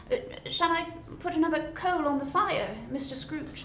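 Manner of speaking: lightly voiced meek clerk; timid clerk. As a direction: ask nervously.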